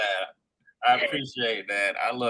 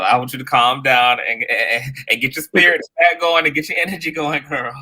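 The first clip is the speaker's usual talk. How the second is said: louder rallying encouragement, building energy; loud pep talk; 3 semitones higher, 9 dB louder than usual. Real, not acted. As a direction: fire her up, loud and upbeat. Host pumping up caller.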